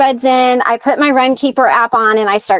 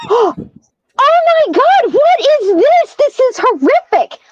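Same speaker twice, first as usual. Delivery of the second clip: very high, drawn-out mock horror; horrified; 12 semitones higher, slower than usual. Wife shown a gruesome photo, high retold horror. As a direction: squeal it, aghast, stretching the words.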